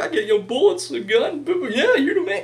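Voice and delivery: high, playful mocking voice with a laugh; mock sarcastic fan. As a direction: tease like a smug heckler. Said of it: "I'm like".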